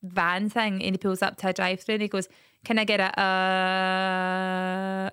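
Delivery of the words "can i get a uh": drawn-out hesitation. Retold or imitated. Mocking a man stalling at the drive-thru, slow and dithering. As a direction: stretch the words out slowly, stalling. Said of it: "and that goes on for ages".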